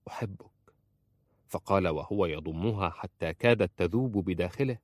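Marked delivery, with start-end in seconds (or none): 0.1-0.5 very soft, hushed confession; sincere whisper. A narrator's reading. Wife confesses love to her husband, sincere whisper. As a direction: confess it tenderly under your breath. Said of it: "همست بصدق".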